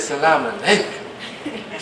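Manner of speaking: soft, drawn-out sultry salam; flirty sweetened greeting. Retold or imitated. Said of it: "did you see how she said it to me".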